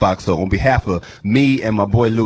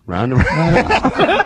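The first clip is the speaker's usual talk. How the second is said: slow drawn-out singsong; 6 semitones higher, much slower than usual. Pretend. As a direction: stretch it slow and high, laughing. Drawn-out joking repeat.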